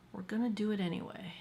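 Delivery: fast conspiratorial whisper; quick sneaky whispered aside. Real, not acted. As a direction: whisper it like a naughty secret. Sneaky aside about dark lip.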